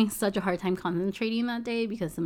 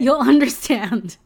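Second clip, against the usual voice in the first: laughs through the words, louder; a burst of laughter carrying the line; laughing; 4 semitones higher, 11 dB louder than usual. Real, not acted. Telling a friend to watch the video, laughing.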